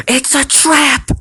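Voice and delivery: loud, high, drawn-out hammed-up yell; mock warning shout. Mock warning about obvious plot twist.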